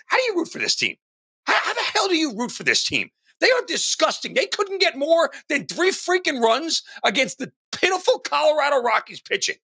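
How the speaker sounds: high-pitched disgusted rant at his team; exasperated rant. Retold or imitated. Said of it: "I said to all my buddies".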